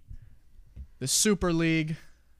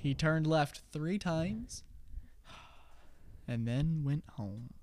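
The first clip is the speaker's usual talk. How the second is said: slow clue; 8 dB quieter, slower than usual. Acted.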